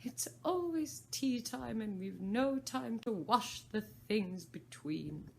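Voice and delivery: slow, high Hatter lament. Acted. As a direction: complain slowly as the Hatter. Hatter voiced in story.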